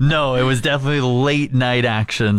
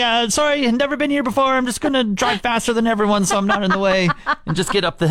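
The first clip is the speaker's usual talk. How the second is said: high nervous newcomer; 9 semitones higher, faster than usual. Retold or imitated. Retold nervous newcomer quote.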